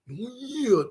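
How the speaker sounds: high, drawn-out Macho "Yeah"; Macho Man impression. Fan imitating Macho Man's catchphrase, high drawn out. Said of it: "he legit talks like that".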